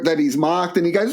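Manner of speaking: ordinary talk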